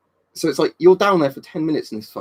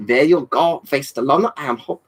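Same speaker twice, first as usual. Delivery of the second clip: hammed-up French accent; 2 semitones higher than usual. Streamer squeezing in one last impression for viewers, French accent. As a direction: put on a comic French accent. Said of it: "One more French accent for the road".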